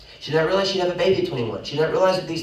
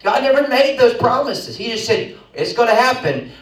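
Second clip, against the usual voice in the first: high, loud preaching; preaching at full force; 8 semitones higher, 6 dB louder than usual. Real, not acted. Preacher insisting God promised only the outcome, full force. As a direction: drive it out to the room.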